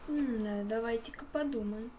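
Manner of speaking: usual speaking voice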